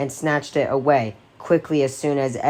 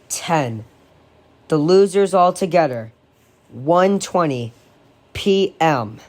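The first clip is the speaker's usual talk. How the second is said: usual speaking voice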